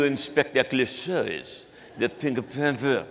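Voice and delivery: jokey, put-on French accent; mock-French movie detective accent. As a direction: ham up the French vowels. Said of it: "my French accent came from".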